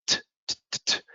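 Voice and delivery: bare T released without a vowel; voiceless T, repeated; isolated T sound. Shown as a demonstration. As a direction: tap out "t" as separate little bursts. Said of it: "these ones have a T sound".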